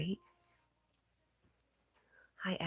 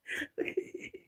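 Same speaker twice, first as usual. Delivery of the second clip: breathy fit of giggles; helpless little laugh. Real, not acted. Streamer tickled by the dancing mushroom, giggling.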